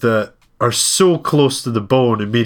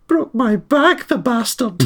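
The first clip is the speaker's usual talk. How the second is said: put-on high, slow old-lady Scottish grumble; imitating a much higher old Scottish nanny muttering a curse; a slow Scottish granny moan, pitched high; Mrs Doubtfire's Scottish voice; 14 semitones higher, slower than usual. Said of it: "the accent comes back on".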